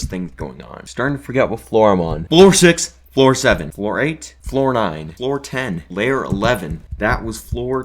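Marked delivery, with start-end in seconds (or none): 2.2-5.8 louder count. Real, not acted. Counting floors excitedly.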